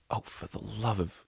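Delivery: soft, slow exasperated hiss. Acted. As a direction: hiss it through annoyance. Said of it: "hissed Octavia".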